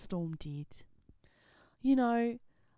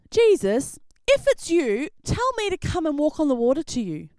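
Peter calling out; 8 semitones higher, 12 dB louder than usual. Acted. Peter calls out from boat.

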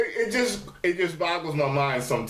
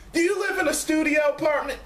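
mock nosy viewer; 14 semitones higher, faster than usual. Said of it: "one of y'all was like".

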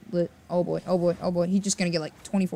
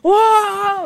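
long, high, loud whoop; amazed yell; 13 semitones higher, 9 dB louder than usual. Real, not acted. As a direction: burst out in astonishment. Amazed by play.

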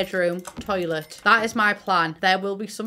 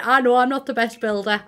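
ordinary talk

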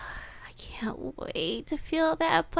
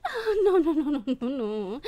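laughing; 2 semitones higher, 4 dB louder, faster than usual. Acted. Laughing at captive trying to rise.

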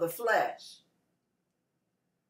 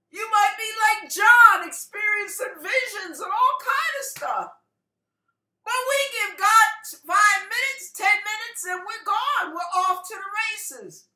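high, loud preacher challenge; fervent exhortation; 10 semitones higher, 5 dB louder than usual. Real, not acted. Preacher urging the congregation to pursue God, fervent exhortation. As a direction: press the congregation with rising fervor.

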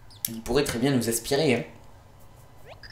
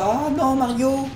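alarmed cry; 14 semitones higher, 3 dB louder, slower than usual. Acted. Alarmed cry voicing character.

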